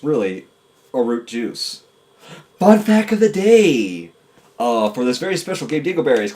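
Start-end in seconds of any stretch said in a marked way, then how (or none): 2.6-4.1 high, drawn-out announcement; hammed-up host call; mock segment announcer. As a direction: call it out slowly like a show segment.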